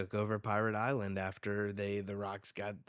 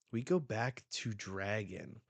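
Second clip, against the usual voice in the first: usual speaking voice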